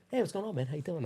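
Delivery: high, easy mock-friendly hello; breezy celebrity greeting. Dwayne Johnson greets gym owner, breezy celebrity greeting.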